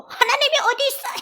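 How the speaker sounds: high-pitched, incredulous jeer; mocking disbelief. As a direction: scoff as a hammed-up unbeliever. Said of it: "조롱하던 사람이에요".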